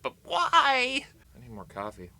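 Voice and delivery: squeaky high "but why?"; mock disbelief. Vlogger mocks the idea of quitting coffee, squeaky high. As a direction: jump way up in pitch, incredulous.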